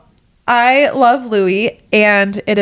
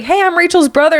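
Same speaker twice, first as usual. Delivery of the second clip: friendly brother; 8 semitones higher than usual. Retold or imitated. Brother voiced at the door.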